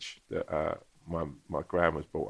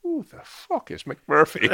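high, loud, slow and laughing; landlady's bafflement; 9 semitones higher, 7 dB louder, slower than usual. Retold or imitated. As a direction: cry it out in amused disbelief. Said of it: "she was like".

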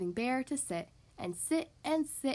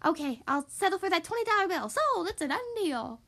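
quick, high huckster patter; hurried, pitched-up sales voice; fast-talking salesman; 5 semitones higher than usual. A narrator's reading. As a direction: close the deal in a rush. Fox hustles Bear into a sale.

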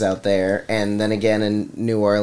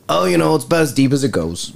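imitated backwoods guide, slightly raised pitch; swamp guide; 4 semitones higher than usual. Guide answers a tourist's depth question, mock swamp-guide voice. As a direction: answer like a local boat guide. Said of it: "every question had an answer like that".

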